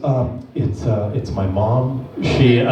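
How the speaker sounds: low, slow, embarrassed mumble; sheepish mumble. Retold or imitated. Buyer sheepishly admits his mom pays. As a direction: mumble it like a caught kid.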